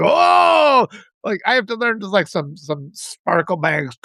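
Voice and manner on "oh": a big, high, loud pretend gasp of amazement; mock wizard wonder. Wizard grandpa wowing little kids, loud playful exclamation. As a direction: dazzle the little ones with a showman's Oh.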